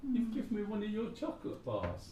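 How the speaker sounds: high, soft, sickly-sweet thanks; sarcastic. Pretend. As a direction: gush with mock gratitude. Mock thanks for chocolate.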